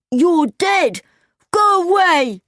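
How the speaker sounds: high-pitched, panicky yell; frightened cry. A narrator's reading. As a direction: cry out to drive off a ghost. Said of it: "cried Chowder".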